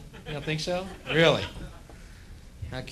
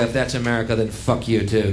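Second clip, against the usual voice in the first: ordinary talk, nothing marked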